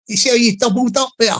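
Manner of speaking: normal talking voice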